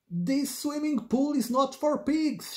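high-pitched mild storybook shout; angry lifeguard shout. A narrator's reading. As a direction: scold like a children's-story lifeguard. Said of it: "shouted the angry lifeguard".

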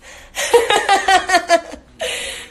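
a long, high, loud laugh. Real, not acted. Cracking up at her own joke to viewers, a high laugh.

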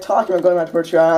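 ordinary delivery

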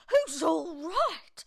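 high, loud, slow outraged yell. A narrator's reading. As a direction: stretch it into an indignant shout. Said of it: "she yelled".

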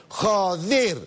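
"Joder" high, leaning on the E; stressed joder. Shown as a demonstration. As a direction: land hard on the E of "Joder". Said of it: "así con acento en la E". ("Joder" con acento en la E)

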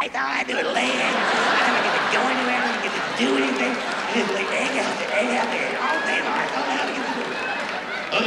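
high-pitched, put-on whiny complaint; bitching queen bee. Queen bee complaining about laying eggs, bitching. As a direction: play a worn-out queen moaning about her job. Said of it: "you know she bitches about it".

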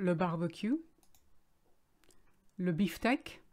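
"le barbecue", "le beefsteak" in a French accent; French accent on the loanwords. Shown as a demonstration. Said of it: "pronounce them with a French accent".

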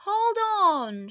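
high, loud rescuer's call; shouted. A narrator's reading. Turtle to the boy in the sea, shouted. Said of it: "shouted Turtle".

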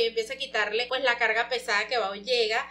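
usual speaking voice

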